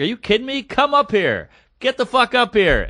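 high-pitched imitated challenge; comic in a mood. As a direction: dare the heckler in mock outrage. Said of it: "She's in a mood".

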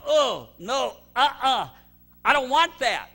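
loud, high acted rejection; hammed-up "no way"; emphatic refusal. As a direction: refuse it like a disgusted customer. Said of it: "when people go".

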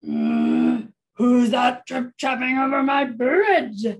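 loud, growly storybook troll; roaring troll. Acted. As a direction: roar it like an angry troll. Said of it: "roared the troll".

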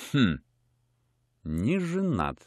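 quiet, thoughtful murmur; musing. Acted. Colonel's wife quietly musing that the lodger is unmarried. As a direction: turn the idea over slowly. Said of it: "проговорила она в раздумье".

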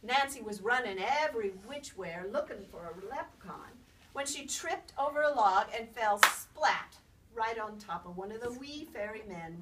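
ordinary delivery, nothing marked

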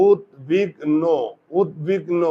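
উদ্বিগ্ন repeated as separated "ud-big-no"; উদ্বিগ্ন in three careful pieces; syllable demonstration. Shown as a demonstration. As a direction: sound out each piece of উদ্বিগ্ন, closed o at the end. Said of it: "ওকার হলো কেন".

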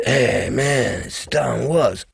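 low, soft, slow laid-back phone voice. Retold or imitated. Producer calling the drummer, low laid-back mock voice. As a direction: drop it down cool and easy, like a guy on the phone.

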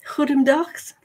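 haltingly attempted Dutch pronunciation; terrible Dutch accent. Greeting a Netherlands viewer for the weekend, bad Dutch accent. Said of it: "that accent was terrible".

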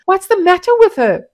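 high, loud alarm. A narrator's reading. Women rushing in at the groan, high and alarmed. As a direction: rush in worried.

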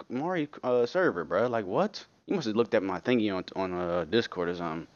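usual speaking voice

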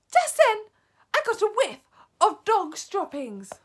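loud, high-pitched alarmed witch; high witch shout. Acted. Witch telling the others what she smelt, shouting high. Witch's line redone in character.

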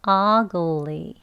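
ugly with the stress on the first syllable; ugly demo. Shown as a demonstration. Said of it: "같이 해볼게요". (ugly 첫 음절 강세)

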